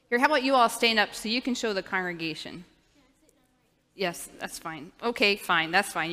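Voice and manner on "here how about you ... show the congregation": normal talking voice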